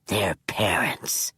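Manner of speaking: slow, low solemn whisper. Acted. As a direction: deliver it gravely and hushed. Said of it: "Manx said solemnly".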